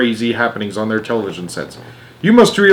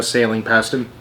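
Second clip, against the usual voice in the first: usual speaking voice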